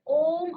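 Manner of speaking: ओम held long on one steady note, slow; long drawn om. Shown as a demonstration. Teacher shows the class a long vowel, ओम drawn out slowly. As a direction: stretch ओम out as a long chanted vowel. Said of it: "लंबा जो स्वर निकालते हैं".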